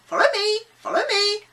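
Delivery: high, loud parrot squawk; calling parrot. A narrator's reading.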